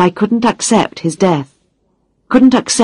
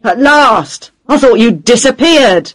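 loud, high irritated boss; angry shout; 6 semitones higher, 4 dB louder than usual. A narrator's reading. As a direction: shout it down the phone at her. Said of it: "he shouted".

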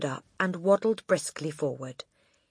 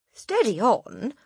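slowed, placating tone; calm her down politely; mildly protesting boy; 7 semitones higher, 5 dB louder, slower than usual. Acted. Boy protesting to the headmistress.

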